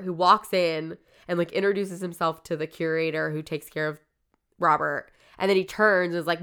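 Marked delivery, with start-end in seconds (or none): none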